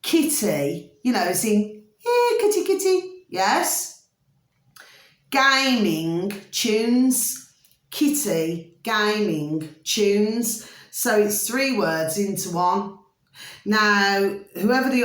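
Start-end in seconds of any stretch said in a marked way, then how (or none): 2.0-3.2 much higher coaxing "kitty, kitty"; calling a cat. Pretend. Explaining the uploader's name by calling a cat, high. 5.3-10.7 very slow, word by word; slow dictation. Shown as a demonstration. Giving viewers the uploader's name, very slowly.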